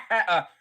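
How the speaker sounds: loud, high evil cackle; supervillain laugh. Acted. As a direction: cackle like a cartoon villain. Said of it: "or supervillain laugh".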